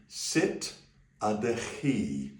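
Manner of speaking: slow careful Welsh; Welsh phrase. Shown as a demonstration. Teacher models a Welsh greeting for learners. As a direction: say each sound of ydych clearly, with a throaty ch. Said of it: "Then we're gonna say".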